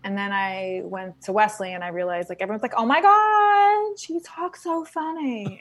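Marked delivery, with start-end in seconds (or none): 2.8-5.6 high, drawn-out mock astonishment; mock amazement. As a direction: imitate classmates gawking at an accent, stretched and high. Mimicking classmates amazed at her accent.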